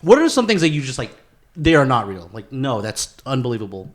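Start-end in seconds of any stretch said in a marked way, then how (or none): none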